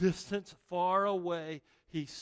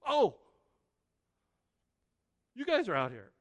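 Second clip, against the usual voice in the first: casual surprise; 6 semitones higher, 7 dB louder than usual. Retold or imitated. Jesus casually notices disciples.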